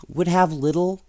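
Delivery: ordinary delivery, nothing marked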